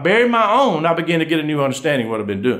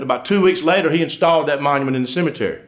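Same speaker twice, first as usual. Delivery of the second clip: ordinary talk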